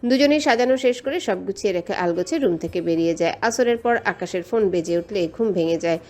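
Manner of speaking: ordinary delivery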